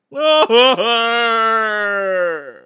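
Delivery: loud, high, long hammed-up wail; exaggerated falling cry; mock wail. Mimicking sobbing reply to Jorge.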